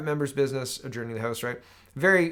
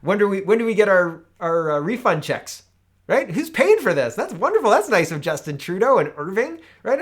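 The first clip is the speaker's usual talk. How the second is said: higher, louder mock delight; sarcastic outrage; 6 semitones higher, 9 dB louder than usual. Real, not acted. Host mocking a free vaccine claim, loud sarcasm. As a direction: ask it dripping with sarcasm. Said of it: "I'm being sarcastic".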